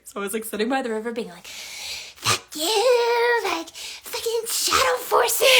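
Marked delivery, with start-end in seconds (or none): 1.5-5.5 much higher and louder, cursing out the dark; high, loud furious curse at the shadow forces; angry shout. Retold or imitated. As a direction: yell it up at the sky, furious. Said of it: "So I was really angry".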